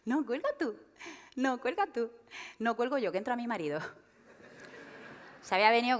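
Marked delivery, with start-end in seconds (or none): 0.1-2.1 higher, cooing "cuelga tú" repeated; lovesick teen. Retold or imitated. Comic mimicking a smitten teen on the phone, lightly cooing. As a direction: play a fourteen-year-old who won't hang up. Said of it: "catorce años diciendo".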